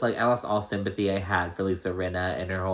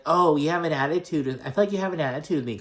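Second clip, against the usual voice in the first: accusing; 6 semitones higher, faster than usual. Retold or imitated. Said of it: "oddly accuses Garcelle of having an attitude".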